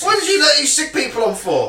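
play-acted angry telegram; mock indignant complaint. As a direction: shout it up high as a hammed-up telling-off. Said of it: "they receive a telegram and say".

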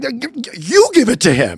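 high stammering laugh through words. Retold or imitated. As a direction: stumble over it while laughing.

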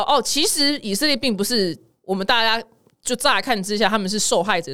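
ordinary talk, nothing marked

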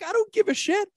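high and loud, brushing the doubt aside; defiant excitement. Retold or imitated. Guest telling a worried host he doesn't care, high and loud. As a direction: toss it off with a big grin.

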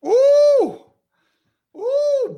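high, drawn-out "oooh"; long falsetto ooh at a hot take; scandalised "ooh". Real, not acted. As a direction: react with a high-pitched, held ooh. Reacts to a contentious opinion.

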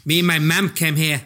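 a light mock Australian accent. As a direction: lightly imitate an Aussie schoolgirl accent. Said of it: "Sandy had that Australian accent".

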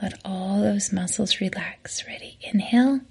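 soft, whispered breathing cues with long pauses. Acted. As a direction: guide each inhale and exhale gently. Leads a breathing exercise.

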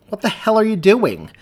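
loud, high, shocked shout; alarmed yell. Acted. Partner shouting at cop kicking the squad car, alarmed yell. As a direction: yell across at him in alarm.

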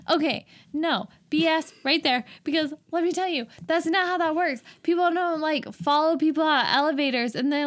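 high-pitched, insistent objection; exasperated rant. Real, not acted. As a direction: set them straight, pitched high. Ranting that pickups don't work so.

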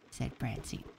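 ordinary delivery

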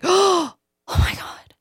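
a breathy rush of delight at a discovery; excited gasp. Retold or imitated. Recalling finding the song line, excited. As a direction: catch your breath at a good idea.

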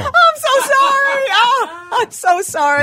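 high, loud laughing apology. Real, not acted. Apologizing to cohost while laughing. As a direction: giggle through the sorry.

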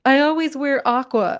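high, breezy mock voice; mock airy quote. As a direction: lightly parody a shallow brand. Mocking shallow branding quote.

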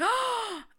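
sharp high gasp of disgust; shocked gasp. Real, not acted. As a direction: suck in air, horrified. Shocked at the bestiality story.

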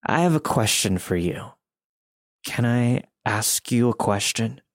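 a hint of slow, slurred menace; slow slurred creepy voice. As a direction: drag the words out slowly and slurred. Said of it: "in that same slow and slurred voice".

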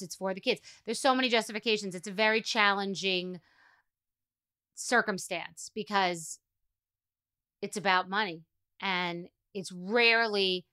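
ordinary delivery

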